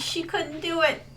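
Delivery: normal talking voice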